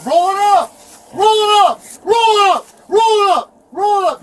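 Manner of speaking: high, loud insistent shouting; real angry, high-pitched demand; repeated yelled order. Real, not acted. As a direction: bellow it over and over at the officer.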